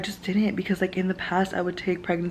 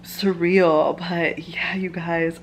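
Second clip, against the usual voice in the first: ordinary talk, nothing marked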